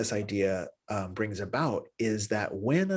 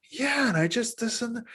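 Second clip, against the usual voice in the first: pitched-up partner trailing off mid-word; higher, lightly voiced partner; 12 semitones higher than usual. Shown as a demonstration. Demonstrating to students a higher voice that trails away. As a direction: let the partner's voice rise and fade out.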